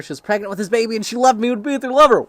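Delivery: high, loud, racing delivery; rushing headlong into a shouted punchline; shocked reading. Real, not acted. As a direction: blurt it out in rising disbelief. Shocked at twist in letter read aloud.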